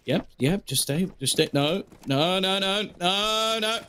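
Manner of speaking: rising frantic no, no, no; mock comms panic; imitated teammate calls. Caster voices teammate calling player back.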